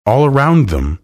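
usual speaking voice

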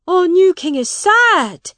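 high, loud cry from the crowd; shouted. A narrator's reading. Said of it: "shouted one man".

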